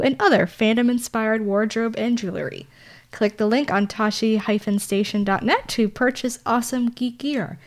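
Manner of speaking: normal talking voice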